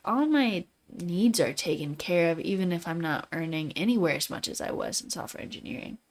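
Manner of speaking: ordinary talk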